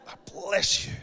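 harsh forced whisper; grudging mock blessing. Blessing whoever cut you off, grudging forced whisper. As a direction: hiss out a blessing you don't mean. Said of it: "Not with, not with sulfur".

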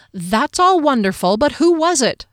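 loud, high, impatient interruption. A narrator's reading. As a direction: cut through the speech, pressing for a name. Impatient friend cuts in.

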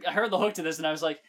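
ordinary talk

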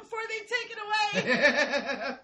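laughing mock panic to grab everything before it's gone; frantic mock urgency. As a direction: squeal it like a looter racing the clock. Gleeful grab of benefits.

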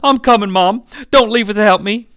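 high plea, moderately loud; tearful child, toned down. Acted. Glenn begging mom not to leave, restrained acted crying. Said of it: "I probably read that wrong".

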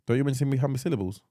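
usual speaking voice

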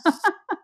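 short burst of genuine laughter; laughing. Real, not acted. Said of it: "because I'm laughing".

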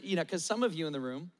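usual speaking voice

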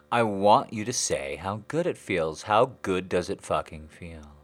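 loud, insistent command; loud demanding. Acted. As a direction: demand it loudly.

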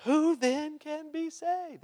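high, slow, incredulous cry; astonished disciples. Retold or imitated. Disciples asking Jesus in disbelief, high astonished voice. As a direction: gasp it out like a stunned disciple. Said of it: "they were greatly astonished".

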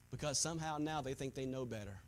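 suddenly low, soft preaching; grave, hushed pulpit aside; hushed disbelief. Real, not acted. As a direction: drop right down, sinking toward a whisper. Sudden hushed drop after forceful preaching.

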